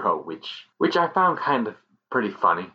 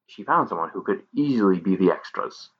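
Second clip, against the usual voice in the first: usual speaking voice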